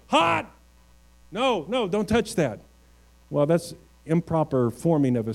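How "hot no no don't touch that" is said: sharp high "Hot!" then urgent "no, no"; a parent snapping a child away from a hot pan lid; warning a child. Retold or imitated. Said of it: "you might say".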